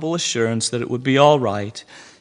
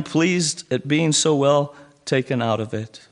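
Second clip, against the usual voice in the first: usual speaking voice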